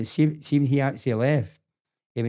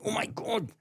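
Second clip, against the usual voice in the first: high, breathy mock shock, much softer; shocked gasp; 10 semitones higher, 8 dB quieter than usual. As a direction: gasp it like you've just seen trouble coming. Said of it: "and I looked at him and went".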